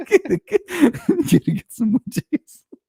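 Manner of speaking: can barely get it out for laughing. Real, not acted. Repeats punchline while laughing.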